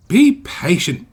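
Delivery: slow, loud, haughty reply; sniffy. A narrator's reading. Engine rebuffs impatient Percy, sniffy. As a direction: draw it out disdainfully. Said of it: "sniffed Henry".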